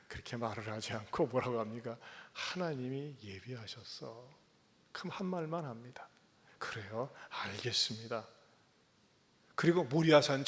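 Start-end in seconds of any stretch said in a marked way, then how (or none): 2.3-4.3 낮고 작게, 천천히 말하는 아버지; 무겁게 조용히 답하기; quiet slow answer. Acted. 아브라함의 조용한 대답. 6.6-8.3 낮고 작게 받아들이는 아들; 순하게 조용히 대답; quiet acceptance. Acted. 이삭의 조용한 순종.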